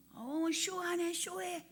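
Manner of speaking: slow, soft, sneering aside; a mocking put-down of a show; scoffing. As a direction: mutter it like you're not fooled one bit. Scoffing at people falling over.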